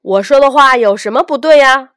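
loud, quick, indignant challenge; angrier retort. A narrator's reading. Peddler challenged by a child over his claims, angrier. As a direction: fire it back fast and loud. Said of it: "小贩更生气了".

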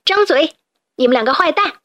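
fierce yell; commanding shout. Acted. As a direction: fling the order at them. Hero hurls root, commands.

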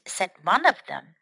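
ordinary talk

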